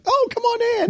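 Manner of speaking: high-pitched homely aunt calling you in; put-on Aunt Bee greeting; Aunt Bee welcome. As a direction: sing out a folksy high-voiced welcome. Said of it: "some woman that looks like Aunt Bee".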